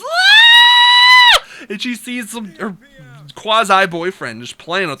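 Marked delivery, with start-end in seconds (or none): 0.0-1.4 very high, loud, drawn-out shriek; acted scream. What she hears opening the door, long mock shriek. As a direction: scream it long, at the top of the range. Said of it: "she just hears".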